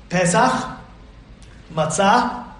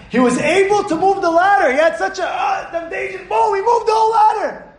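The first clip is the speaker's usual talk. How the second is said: dramatic excitement; 11 semitones higher, 3 dB louder than usual. A narrator's reading. Dramatic story climax.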